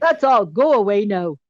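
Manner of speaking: loud, punchy attempt at a Scottish accent; bad Scottish accent. Quoting a YouTube film critic's sign-off, bad Scottish accent. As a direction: put on an amateur Scottish burr. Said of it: "Pardon my bad Scottish accent".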